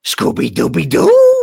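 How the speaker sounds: loud cartoon dog howl with a laugh; goofy dog call into a high giggle; Scooby-Doo voice. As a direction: bark it out like Scooby. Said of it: "original voice of".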